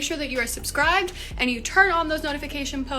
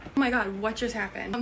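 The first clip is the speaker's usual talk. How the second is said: ordinary delivery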